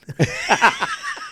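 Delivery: burst of laughter. Real, not acted. Laughing at colleague.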